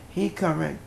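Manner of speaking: ordinary delivery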